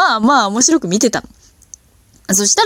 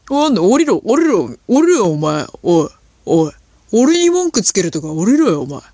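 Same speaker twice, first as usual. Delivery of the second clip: mock angry drunk. Drunk man yelling at stammerer.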